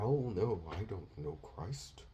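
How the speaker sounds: soft, timid denial voice; meek Peter. Retold or imitated. Preacher mocking a timid Peter denying Christ, soft and meek. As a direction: hush it like a scared man. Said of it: "He wasn't standing there".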